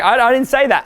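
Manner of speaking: half-joking panicked excuse; mock flustered denial. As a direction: blurt it out like you're caught. Acted denial quote.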